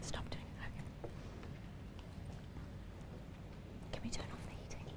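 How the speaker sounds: real hushed whisper; whispered aside. Real, not acted. Speaker to helpers about the heating, whispered aside. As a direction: whisper it off to the side, barely audible.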